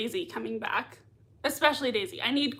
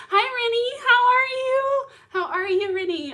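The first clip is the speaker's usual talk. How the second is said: excited greeting; 12 semitones higher, 5 dB louder than usual. Real, not acted. Greeting a viewer.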